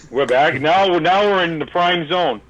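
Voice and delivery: ordinary talk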